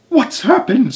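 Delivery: high, loud panicked scream. A narrator's reading. Missus Twit screams in panic at the upside-down room, very high. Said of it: "screamed Missus Twit".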